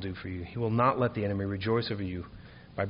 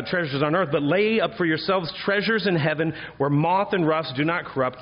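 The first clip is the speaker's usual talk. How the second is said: high, loud, ringing preacher's cadence; preaching at full force; 5 semitones higher, 4 dB louder than usual. Acted. Pastor exhorting his congregation about David, full force. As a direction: proclaim it to the back pews.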